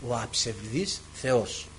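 low, soft, very slow pronouncement; solemn inner voice. Retold or imitated. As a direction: answer slowly and quietly as a divine voice. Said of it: "ξανά η φωνή μου λέει".

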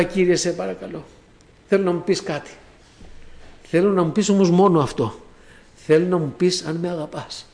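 low, soft, pleading prayer murmured to God; hushed retold prayer. Retold quiet prayer after hard day.

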